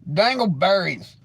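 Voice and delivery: high, drawn-out exclamation; mock outcry. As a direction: stretch it out high like a silly curse.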